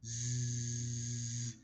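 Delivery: a sleepy drawn-out snore; snoring. A narrator's reading. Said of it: "Amy snored".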